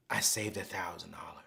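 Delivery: retold confession in a soft whisper. Young lady confiding her savings to Anthony, whispered. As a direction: breathe it like a secret told into the ear. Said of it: "can I whisper something in your ear".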